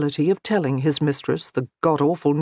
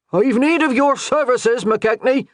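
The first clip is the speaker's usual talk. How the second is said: bellowed out, higher and louder, calling across wind; bellowing; 8 semitones higher, 4 dB louder than usual. A narrator's reading. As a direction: call out to the priest over the wind. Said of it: "Kelmert bellowed over the rising wind".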